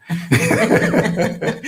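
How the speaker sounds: a playful laugh trailing off the praise; tease, then break into laughter. Real, not acted. Co-host laughing while praising the assistant.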